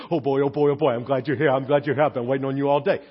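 lightly voiced happy dog; eager dog voice. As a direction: play an overjoyed dog at the door. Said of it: "their cat is waiting on them and says".

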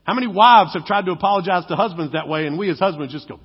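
ordinary talk

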